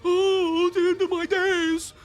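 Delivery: high, wailing mock lament; mock whiny fan. Vlogger mocks fans excusing the game with a high wailing lament. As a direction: wail it like a melodramatic fanboy.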